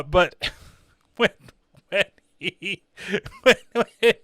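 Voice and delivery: real laughter breaking up the words; can't get the sentence out for laughing. Real, not acted. Reviewer recalling the kid's healing scene, cracking up as he talks.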